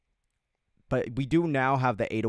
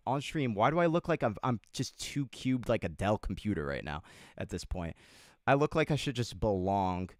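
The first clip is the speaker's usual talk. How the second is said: ordinary talk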